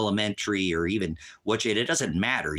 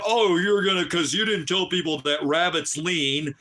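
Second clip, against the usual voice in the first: mocking quote; 7 semitones higher, 4 dB louder than usual. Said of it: "mocking the chat user".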